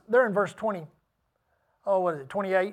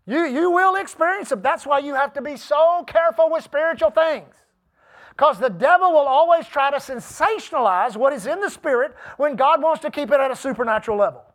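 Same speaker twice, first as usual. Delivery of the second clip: high-energy preaching, pitched up and punchy; charged preaching; 4 semitones higher, 7 dB louder than usual. Real, not acted. Preacher urging congregation to pray the Word, charged preaching. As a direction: drive it home to the congregation.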